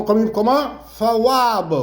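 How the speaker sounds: usual speaking voice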